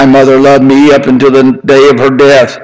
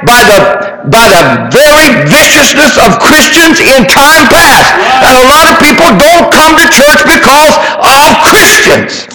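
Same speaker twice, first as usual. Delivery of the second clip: high, ringing preacher's call, building in fervor; full-force preaching; 9 semitones higher than usual. Acted. Preacher urging the congregation to love more, ringing sermon. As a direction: preach it like you're lifting the whole room.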